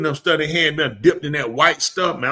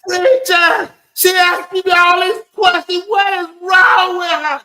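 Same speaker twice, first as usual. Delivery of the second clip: high, drawn-out hysterical yelling; mock pain-wracked shriek; screaming; 7 dB louder, slower than usual. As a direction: imitate a caller screaming in agony. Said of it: "she called me yelling and screaming in my ear".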